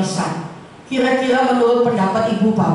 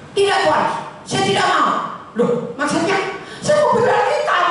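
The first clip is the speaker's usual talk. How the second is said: lightly voiced youth, higher and firm; earnest young man; 8 semitones higher than usual. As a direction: play the young man refusing firmly. Young man refuses money. (pemuda menolak uang dengan suara agak tinggi)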